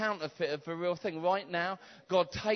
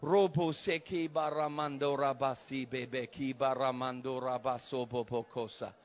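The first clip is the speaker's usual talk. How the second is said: speaking in tongues; 6 semitones lower than usual. Acted. Preacher praying in tongues over the church, low murmured tongues. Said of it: "speaking in tongues".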